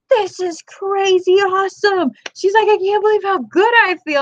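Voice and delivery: high, amazed gush; excited friend. Retold or imitated. Friend thrilled telling narrator kombucha works, high excited gush. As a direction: gush like it changed your life.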